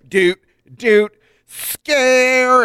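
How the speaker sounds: spooky organ sound hummed as doot doot; mock spooky organ; mimicked organ notes. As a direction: imitate a creepy organ. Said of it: "It said".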